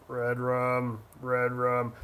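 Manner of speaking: low, quiet, creepy horror-movie voice; low, spooky, soft creepy intonation; creepy Redrum voice. Shown as a demonstration. Repeats restaurant name, then spooky.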